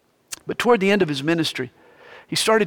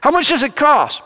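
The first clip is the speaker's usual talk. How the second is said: put-on eager kid; 9 semitones higher, 4 dB louder than usual. Boy voiced in story.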